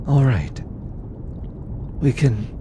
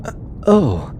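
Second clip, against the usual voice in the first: stammered, higher-pitched oh; flustered surprise; 5 semitones higher, 4 dB louder than usual. Acted. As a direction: react as if caught off guard. Caught off guard by hand request.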